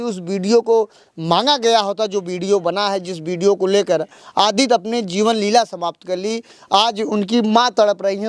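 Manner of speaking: earnest field-reporter summing up for camera; news report. Acted. Reporter wraps up the story for viewers, earnest news delivery. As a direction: report it like a TV correspondent on location.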